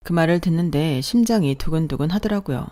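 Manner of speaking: ordinary delivery, nothing marked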